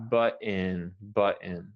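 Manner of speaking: button as bʌtɪn, a light I in the second syllable; button with short I. Shown as a demonstration. Teacher modelling the vowel of button. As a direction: say button ending in -tin. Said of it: "So it's more like an I".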